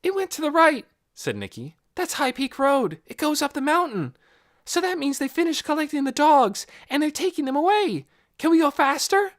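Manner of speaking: put-on high, loud, eager girl voice; girl begging to chase the bus; urgent girl. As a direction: press her to hurry. Nikki urgently chasing the bus.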